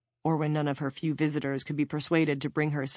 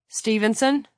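raised, questioning call; calling from afar; 6 semitones higher, 5 dB louder than usual. A narrator's reading. Officer calling to an inmate down the hallway, calling out. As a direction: call out higher and louder, asking. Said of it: "from down the hallway".

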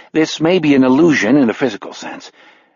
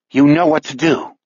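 cold; 3 semitones higher than usual. A narrator's reading. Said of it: "Judy tells him coldly".

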